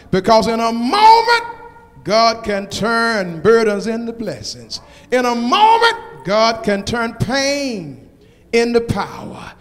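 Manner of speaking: loud rising preacher's call, building; preaching at full force. Acted. Pastor calling out to the congregation, preaching at full force. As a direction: whoop the promises at the crowd.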